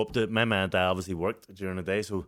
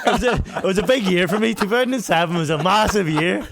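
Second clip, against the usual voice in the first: laughing it out, voice up and loud; giggling through the words; loud, laughing brag; 10 semitones higher, 8 dB louder than usual. Real, not acted. Laughing about a big year.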